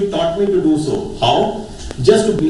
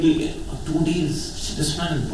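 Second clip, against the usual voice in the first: weary sigh; 6 dB quieter, slower than usual. Retold or imitated. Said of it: "you feel like".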